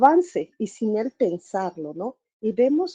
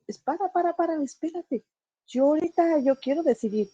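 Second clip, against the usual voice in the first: put-on a lightly raised, higher voice of a child wanting to decide; mock child protest; 6 semitones higher than usual. Grown child telling mom to back off, lightly voiced higher. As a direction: play the grown kid pushing back on mom, a touch high. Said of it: "es como".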